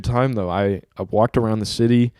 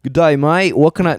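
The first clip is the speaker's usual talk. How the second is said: botched British that comes out Australian, higher and louder; Australian accent; 5 semitones higher, 5 dB louder than usual. Retold or imitated. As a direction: greet like an Aussie. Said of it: "nope, that's Australian".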